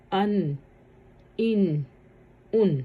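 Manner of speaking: ان said short with a clear ringing n; tanween drill. Shown as a demonstration. Teacher showing hamza and alif sound alike, clear nasal n. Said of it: "دونوں کی ایک ہی آواز نکلے گی".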